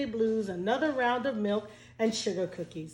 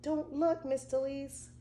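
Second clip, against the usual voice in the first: put-on child pleading; 4 semitones higher, 4 dB quieter than usual. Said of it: "She's like".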